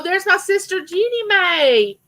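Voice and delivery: high, slow happy welcome; light up at seeing your sister; delighted greeting. Real, not acted. Spotting her sister in the chat, delighted.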